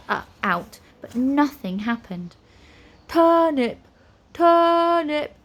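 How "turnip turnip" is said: high, loud, drawn-out plea; pleading. A narrator's reading. Eric points at the creatures, pleading. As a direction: beg with the one word you have. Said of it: "pleaded Eric".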